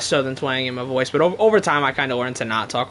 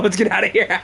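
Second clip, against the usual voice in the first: laughing; 4 semitones higher than usual. Real, not acted. Cracks up at own joke.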